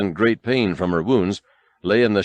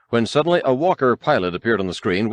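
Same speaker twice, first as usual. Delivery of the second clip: plain reading voice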